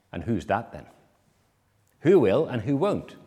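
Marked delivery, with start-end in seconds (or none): none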